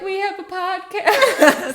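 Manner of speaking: loud, slow, laughing sign call; excited shout. Pretend. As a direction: yell it out like holding a sign. Acting out holding up signs.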